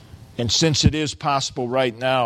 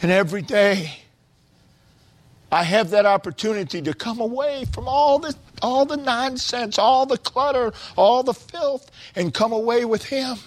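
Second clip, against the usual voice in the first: high-pitched, pleading exhortation without shouting; fervent preaching; 10 semitones higher than usual. Real, not acted. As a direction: preach it high, piling up the list. Preacher urges listeners to come away.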